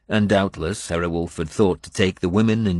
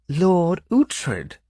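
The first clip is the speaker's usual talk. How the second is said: high, drawn-out cry of amazement; a lady voiced in wonder, name stretched out; lady amazed to see her rescuer; 11 semitones higher than usual. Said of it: "she said in a tone of amazement".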